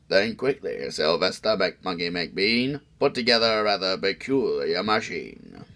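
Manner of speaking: ordinary delivery, nothing marked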